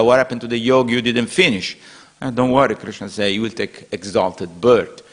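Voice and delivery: normal talking voice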